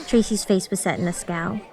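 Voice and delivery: usual speaking voice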